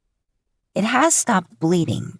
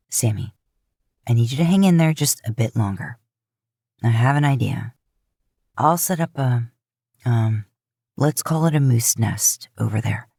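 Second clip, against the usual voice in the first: put-on lower, soothing older-brother voice, understated; steady him with a slightly lower voice; lightly voiced Dean; 5 semitones lower than usual. A narrator's reading. Dean reassuring Sam.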